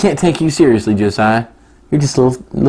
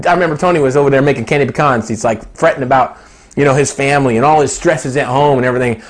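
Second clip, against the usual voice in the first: ordinary talk, nothing marked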